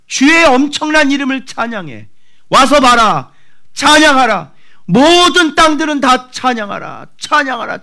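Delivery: high and loud commanding praise; wild proclamation of praise; frenzied praise. Retold or imitated. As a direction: order the whole earth to praise. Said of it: "뭐 미쳤나 봐".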